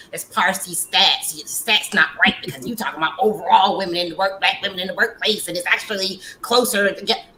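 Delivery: imitating a pedantic doubter, a bit pushy; lightly mocked know-it-all rebuttal; mock nitpicking objector. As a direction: mimic someone quibbling over figures. Mimicking people who dispute pay stats.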